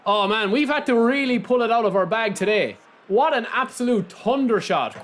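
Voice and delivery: ordinary talk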